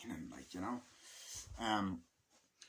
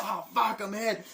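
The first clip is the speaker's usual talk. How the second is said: high, louder cry of a hit soldier; mock wounded soldier; 11 semitones higher, 6 dB louder than usual. Retelling a soldier getting shot, high mock yell. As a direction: yelp it like you just got shot. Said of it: "he's like".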